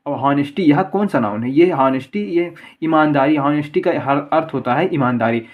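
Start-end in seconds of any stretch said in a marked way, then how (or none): none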